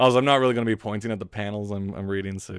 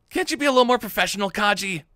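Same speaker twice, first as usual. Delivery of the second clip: high, loud, quick nagging; scolding partner; 12 semitones higher, 4 dB louder, faster than usual. Acted. Agency partner telling Kaji to be professional, scolding. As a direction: nag fast in a raised, high voice.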